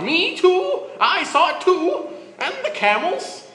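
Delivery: put-on very high, loud cracked old-man yell; a wild old coot butting in; crazy old man shout. A narrator's reading. Old Levi bursting in to agree with Miriam, shrill crazed yell. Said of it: "reappear and shout".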